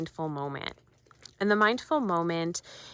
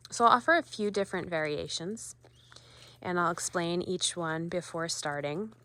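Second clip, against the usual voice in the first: ordinary delivery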